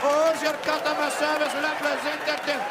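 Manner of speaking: put-on high-pitched, forceful Indian-accented cry; hammed-up Indian-accented climax; climactic Indian accent. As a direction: belt it out in a mock Indian accent. Climax of Indian dirty talk.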